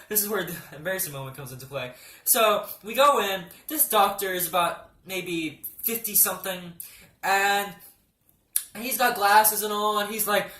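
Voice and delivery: usual speaking voice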